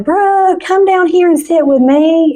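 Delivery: drawn-high motherly call-out; calling to daughter. Retold or imitated. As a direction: coax her down from another room.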